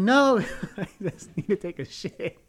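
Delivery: loud, high, drawn-out protest breaking into laughter; hammed-up exasperated shout; exasperated refusal. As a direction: replay the desperate no, laughing. Acting out his exasperated refusal.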